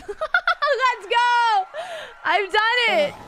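loud, high shouts of victory; elated, whooping celebration; triumphant cheer. Real, not acted. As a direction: yell it out like you've just won. Celebrating coming full circle.

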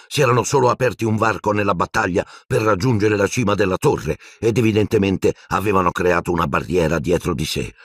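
ordinary delivery

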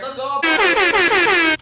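high, loud celebratory yell; excited shout. Real, not acted. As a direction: scream it out in triumph. Celebrating hitting hundred subscribers.

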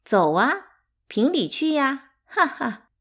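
loud, high dare with a scoffing laugh; jeering send-off; cold taunt. A narrator's reading. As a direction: dare them, mocking. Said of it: "走时冷冷地说".

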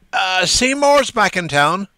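put-on young man's voice. A narrator's reading. Young man's quoted line.

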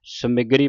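ਸਮਗਰੀ with short i after m; correct short-i reading. Shown as a demonstration. Teacher showing the right reading, ਸਮਗਰੀ as "sa-mi-gree". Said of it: "ਸਮਗਰੀ ਪੜ੍ਹਨਾ ਹੈ".

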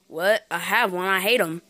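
a low, soft, grumbling customer. Acted. Customer answers in commercial.

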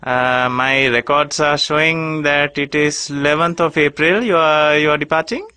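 ordinary talk, nothing marked

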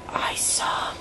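breathless hushed whisper; gasping whispered aside; awed whisper. Real, not acted. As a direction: whisper it in awe. Awed gasp before.

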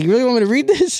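high, fast, disbelieving mimic of his buddy; buddy's incredulous voice. Buddy asking his friend if he really wants it read, high disbelief. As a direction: squeak it out like a friend who can't believe it. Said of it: "He's like".

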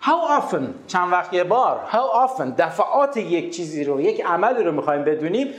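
normal talking voice

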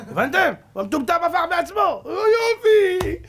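very high, loud climax; exultant shouting. Real, not acted. Preacher crowing that the fool flipped it himself, high exultant shout. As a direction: whoop the punchline to the crowd.

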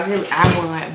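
ordinary delivery

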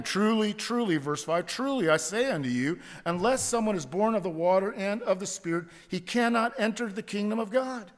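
high, loud, insistent truly; emphatic Jesus. Acted. Preacher voicing Jesus to Nicodemus, emphatic and loud. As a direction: press every word home. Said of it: "here's Jesus emphatic again".

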